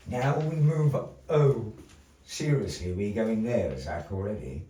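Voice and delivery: ordinary talk, nothing marked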